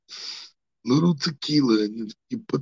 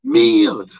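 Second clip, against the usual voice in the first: loud exclamation; 13 semitones higher, 9 dB louder than usual. Acted. Exclaims after the promo.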